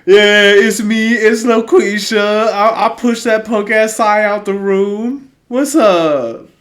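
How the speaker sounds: loud, high, hammed-up character takeover; Loquisha. Co-host pretends Loquisha took over the podcast, loud put-on voice. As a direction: burst in as a brash mock persona. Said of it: "we got Loquisha on the podcast".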